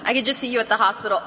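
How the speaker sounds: usual speaking voice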